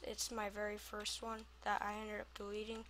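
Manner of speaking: ordinary talk, nothing marked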